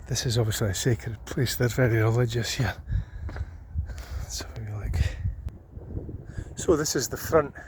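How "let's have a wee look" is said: whispering. Real, not acted. Quiet aside while exploring.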